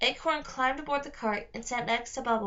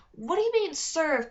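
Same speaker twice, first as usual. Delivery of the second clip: alarmed; 6 semitones higher, 4 dB louder than usual. Acted. Alarmed question to captors.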